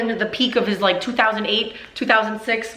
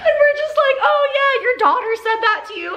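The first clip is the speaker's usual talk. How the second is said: laughing. Real, not acted.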